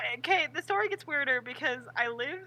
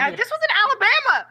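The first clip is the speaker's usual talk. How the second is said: much higher and louder; loud dramatic aside; 9 semitones higher, 13 dB louder than usual. Acted. Friend setting up emu story, loud dramatic aside. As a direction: announce it like a big reveal.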